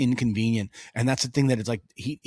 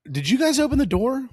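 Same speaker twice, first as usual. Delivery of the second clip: higher, rising surprised question; questioning kids; 10 semitones higher than usual. Retold or imitated. Dad asks his kids about the opened door, higher. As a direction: ask it high and incredulous.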